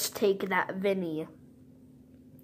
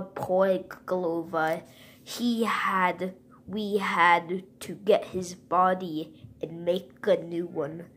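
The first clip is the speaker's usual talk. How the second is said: ordinary talk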